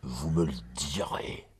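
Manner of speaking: whisper. Acted. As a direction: breathe it secretly to the lord. Secret exchange, hushed reply.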